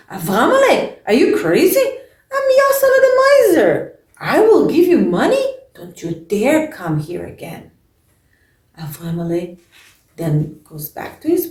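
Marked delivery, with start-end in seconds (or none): 0.1-7.7 shouted like a madman, much higher and louder; angry shout. Acted. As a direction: throw him out with a furious yell. Miser throws beggar out.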